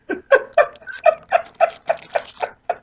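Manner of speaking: high airless wheezing laugh; breathless wheeze laugh. Real, not acted. Telling the story, he wheezes with silent laughter.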